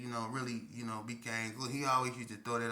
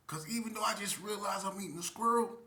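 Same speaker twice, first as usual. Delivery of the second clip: normal talking voice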